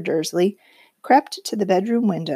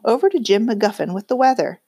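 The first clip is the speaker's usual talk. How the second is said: ordinary delivery, nothing marked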